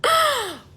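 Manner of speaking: sharp high gasp; mock-shocked gasp at her own story. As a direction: gasp in disbelief. Reacting to sixteen hours locked up.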